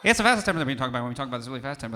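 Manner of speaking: rattled off very fast in one breath; fast demo. Shown as a demonstration. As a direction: show how fast presto talk sounds. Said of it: "they might be talking like this".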